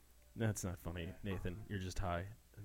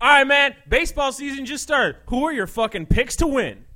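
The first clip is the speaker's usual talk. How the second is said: much higher and louder meathead bro; 8 semitones higher, 9 dB louder than usual. Acted. Bro asking his buddy for baseball picks, loud. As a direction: yell it like a sports bar bro.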